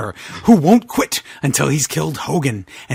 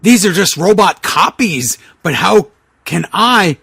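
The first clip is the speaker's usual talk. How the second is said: usual speaking voice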